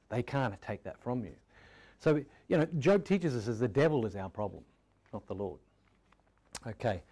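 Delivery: normal talking voice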